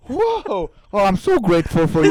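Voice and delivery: loud, high, over-the-top awe; hammed-up ecstatic thanks; gushing mock gratitude. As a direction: gush as if overwhelmed with bliss. Acting out blissful mock enlightenment.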